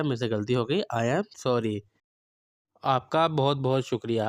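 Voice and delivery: ordinary talk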